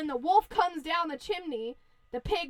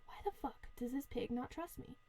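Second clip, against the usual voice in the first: much softer wolf complaint; hurt grumbling; 2 semitones lower, 12 dB quieter than usual. Acted. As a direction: grumble quietly to yourself, as if slighted.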